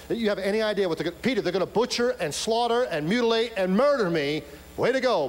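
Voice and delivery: loud, high-pitched mock tirade; sarcastic rebuke. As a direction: lay into Peter with hammered-up, shouted reproach. Acted angry rebuke of Peter.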